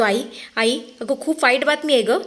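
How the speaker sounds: a touch of tears in the voice; breaking a bit with bad news; choked-up voice. A narrator's reading. Son phoning his mother with terrible news, choked up. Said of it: "गहिवरल्या आवाजात तो बोलतो".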